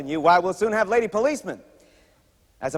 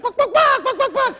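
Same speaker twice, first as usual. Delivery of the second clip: high, loud buck-buck clucking; chicken cluck; 4 dB louder than usual. Acted. Comic between jokes lets out a loud high chicken cluck.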